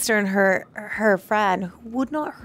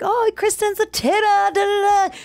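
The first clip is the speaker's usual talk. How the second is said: high-pitched mimicked chatter; mock gossip; 10 semitones higher than usual. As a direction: parody excited school gossip. Mimics the school gossip about her.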